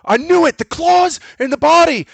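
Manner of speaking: high, loud mock freak-out; hammed-up cry of vindication; retold triumphant yell. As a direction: yell it like a paranoid man proved right. Retold man accusing the werewolf.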